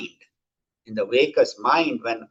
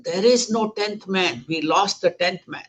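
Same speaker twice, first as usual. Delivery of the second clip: mildly acted dismay; 7 semitones higher than usual. Disciple reporting a lost companion before they cry, mildly dismayed. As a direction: lightly act a worried disciple.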